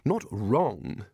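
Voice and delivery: flustered denial, starting high then dropping; repeated words tumbling into a careful stop; hasty backtracking. A narrator's reading. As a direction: stammer out a quick reassurance. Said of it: "said Ridcully quickly".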